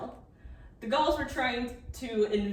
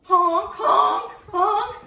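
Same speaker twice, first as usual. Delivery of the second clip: goose honk; 12 semitones higher, 11 dB louder, much slower than usual. Acted. Goose in the show.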